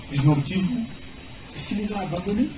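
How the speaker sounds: ordinary talk, nothing marked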